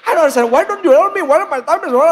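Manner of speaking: high, loud mock Indian-accented rant; sing-song Indian accent, worked up; angry Indian accent. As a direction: imitate a comically angry Indian complainer. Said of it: "saya lucu lihat dia marah".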